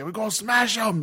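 high, growly British yell at moderate volume; mock British battle cry in a growl; growly British accent. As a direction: imitate a screaming British Luddite. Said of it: "scream in this, like, growly, like, British accent".

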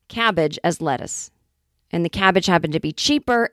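ordinary talk

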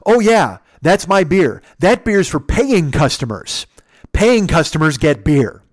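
loud, emphatic mock indignation; retold pointed retort. Old man claims his beer from the intruder, pointedly. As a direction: imitate a belligerent man driving the point home slowly.

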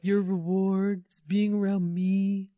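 slow, drawn-out imitation of Trump; a lightly voiced Trump boast; bad Trump impression. The podcast host jokes as Trump, slow and smug. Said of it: "That's my bad Trump impression".

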